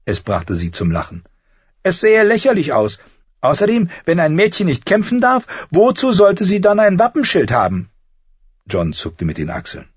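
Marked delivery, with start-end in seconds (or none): 1.8-7.9 much higher, louder character voice; young Arya. A narrator's reading. Sister arguing shields with her brother, high and indignant.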